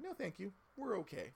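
high-pitched, airy corporate refusal; polite brush-off. Retold or imitated. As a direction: wave them off politely in a high voice. Voices company politely turning boss down.

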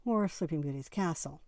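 ordinary delivery, nothing marked

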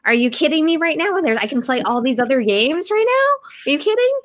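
squealing, disbelieving joy, rising high; amazed excitement. Retold or imitated. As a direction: gush like a kid discovering games. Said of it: "I was like".

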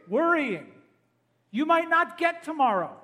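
ordinary talk

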